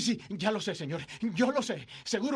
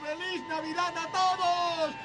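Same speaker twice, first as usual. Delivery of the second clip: higher, slowed joyous yells to the town; laughing whoops of Christmas joy; joyful shouting; 5 semitones higher than usual. Acted. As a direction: call out to everyone, delirious. George shouting greetings through the town.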